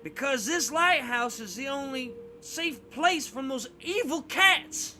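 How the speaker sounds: put-on high, loud frightened old-man voice, slowing on evil cats; old man warning the kids about evil cats; fearful old man. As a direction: warn in dread of the cats. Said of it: "eyes widen fearfully".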